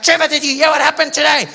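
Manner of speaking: high, loud acted alarm; hammed-up urgent woman; urgent news-bringing. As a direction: burst in with shocking news. Woman bringing shark attack news.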